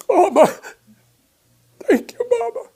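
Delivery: high, choked-up thanks; tearful thanks. Retold or imitated. Tony under the stars, tearfully thanking Mama. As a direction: thank her with tears in your eyes. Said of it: "his eyes are filled with tears".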